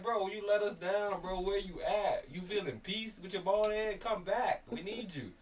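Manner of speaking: high, loud, slow pleading rant; pleading upset fan. Retold or imitated. Fan begging the player to return to the team, loud pleading. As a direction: beg him to come back. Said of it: "I'm so upset with him".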